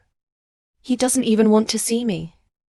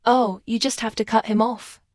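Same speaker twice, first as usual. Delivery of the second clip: ordinary delivery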